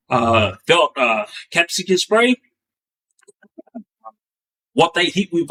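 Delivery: ordinary talk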